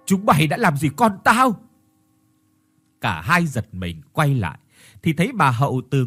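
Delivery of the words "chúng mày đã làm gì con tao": high furious yell; shouting. Acted. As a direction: scream it across the yard. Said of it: "một giọng hét đã vang lên".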